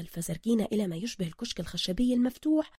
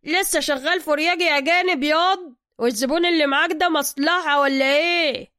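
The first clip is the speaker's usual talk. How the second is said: higher, louder workshop boss; gruff master mechanic; 6 semitones higher, 7 dB louder than usual. Acted. Zergina greeting Soka in his workshop, higher and louder. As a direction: greet him like you own the place.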